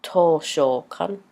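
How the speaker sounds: Toshokan said slowly with a rise at the end; slow repeat. Shown as a demonstration. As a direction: drawl Toshokan out for the learner. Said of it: "a library is toshokan".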